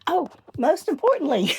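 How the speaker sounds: ordinary talk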